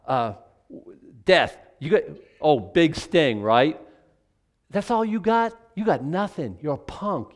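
preacher voicing Paul taunting death; trash talk to death. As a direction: sneer at the grave like a punk. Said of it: "Paul's saying".

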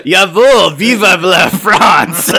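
loud, high mock-European cry; muddled foreign accent. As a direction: belt out a botched French-German accent, stretched out. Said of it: "my French accent in the play in high school was a Russian accent".

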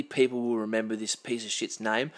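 ordinary talk

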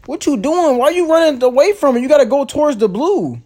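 exasperated high yelling. Real, not acted. High exasperated shouting at partner.

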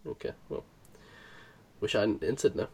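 low, quiet pretend letdown; mock sulk. Host learning the contest only wins bragging rights, mock sulking. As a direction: sulk softly, as if regretting it.

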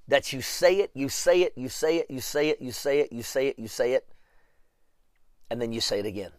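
rhythmic repeats stepping down in pitch and volume; fading repetition. Real, not acted. Preacher showing how often to say it, repeating and fading. Chanting repetition to model persistence.

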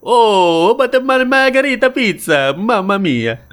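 gushing, high-pitched cartoon Italian; hammed-up Italian delight; mock Italian accent. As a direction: swoon over it in fake Italian. Acts out a charmed reaction to Italians.

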